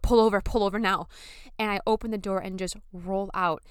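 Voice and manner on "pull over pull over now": loud urgent demand; shout. Retold or imitated. Wife telling her husband to stop the car, loud shout. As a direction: order the car to stop right now. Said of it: "I just shout".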